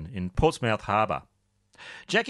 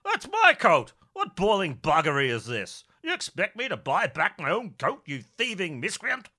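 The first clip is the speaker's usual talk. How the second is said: high, indignant squire, spluttering; fury at being sold his own coat; outraged gentleman; 6 semitones higher than usual. Acted. Rickett is offered his own stolen coat, outraged and high.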